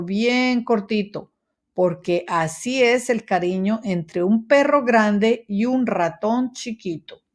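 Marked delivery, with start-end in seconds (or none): none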